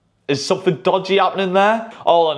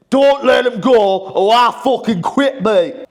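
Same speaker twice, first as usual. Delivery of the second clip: loud, slow put-on foreign manager accent, higher; mock Glasner accent; 7 semitones higher, 3 dB louder, slower than usual. Glasner threatening chairman Parish to keep Guéhi, loud mock accent. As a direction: bang the table and demand it in a hammed-up foreign accent. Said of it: "That's not his accent".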